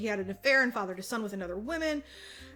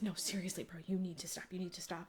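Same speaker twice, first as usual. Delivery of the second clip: softer, pleading, fading; softer earnest plea; quiet begging; 2 semitones lower, 8 dB quieter than usual. Retold or imitated. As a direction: beg her quietly to stop. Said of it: "he's begging her".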